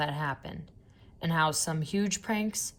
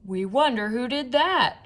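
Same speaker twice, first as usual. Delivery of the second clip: higher, louder group question; crowd shout; 6 semitones higher, 5 dB louder than usual. A narrator's reading. Fans in the bleachers shouting together, higher and louder. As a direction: call it out together from the stands. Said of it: "shouted the fans".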